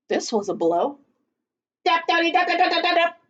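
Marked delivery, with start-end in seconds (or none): 1.9-3.2 quick, higher dap-dap pecking; rapid mock pecking sounds; woodpecker tapping. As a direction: tap it out like a beak on wood.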